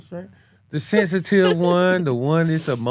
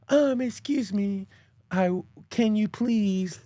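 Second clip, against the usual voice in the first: high, slow, prissy little voice; mock dainty voice; 4 semitones higher, 3 dB quieter, slower than usual. Man mocking sweetened talk for his wife, dainty and slow. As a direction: mince it out like an overly polite request.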